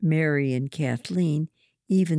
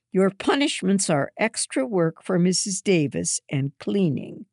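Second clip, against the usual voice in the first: announcing; 5 semitones higher, 4 dB louder than usual. A narrator's reading. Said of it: "Mother announces".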